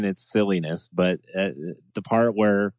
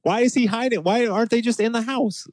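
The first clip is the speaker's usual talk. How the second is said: baffled; 11 semitones higher than usual. Real, not acted. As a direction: ask in high disbelief. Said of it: "mock confusion".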